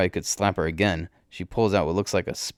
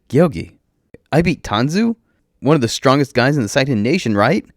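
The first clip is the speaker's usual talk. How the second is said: high, loud astonished shout; realizing shout; 8 semitones higher, 7 dB louder than usual. A narrator's reading. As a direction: shout as it finally sinks in. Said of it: "Vane shouts like he's just now realizing".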